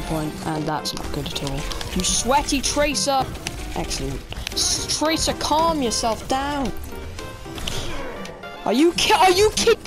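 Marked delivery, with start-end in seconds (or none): 1.9-3.2 raging gamer. Real, not acted. Yelling at enemy player. 4.5-6.7 raging gamer. Real, not acted. Yelling at enemy player. 8.6-9.8 furious high yell; raging gamer. Real, not acted. Raging at game death.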